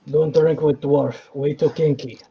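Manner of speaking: a hint of a hammed-up Russian lady; lightly voiced Russian accent. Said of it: "Apparently now she's Russian".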